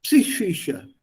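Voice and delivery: "Psychische" as psi plus a sh sound; assembled pronunciation. Shown as a demonstration. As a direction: put "Psychische" together clearly, p and s sounded, then sh. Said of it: "And then with a sh".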